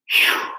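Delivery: airy exhaled whistle-like sigh; relieved phew. A narrator's reading. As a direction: blow out the breath in relief. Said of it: "she said".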